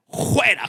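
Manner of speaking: "Fuera" begun with a raspy J sound, "juera"; imitating a brother's "Fuera" said as "juera". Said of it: "con J le dijo".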